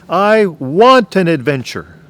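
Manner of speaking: drawn-out imitation yell, high and loud; retold yell. Husband imitates wife's repeated call for adventure, slow yell. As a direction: stretch the yell out slowly, imitating her. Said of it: "And then she yelled again".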